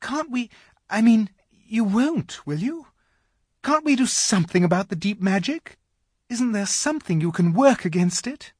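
put-on high, pleading girl voice. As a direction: beg him like a young girl. Susan pleads with Aslan.

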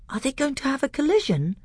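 put-on high, fast, wondering boy's question; awestruck. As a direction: ask it in breathless wonder. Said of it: "he asked in an awestruck voice".

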